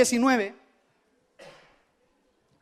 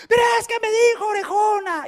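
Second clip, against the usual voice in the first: the upset daughter shouting back; wailing little girl; 9 semitones higher than usual. Retold or imitated. Crying girl insists.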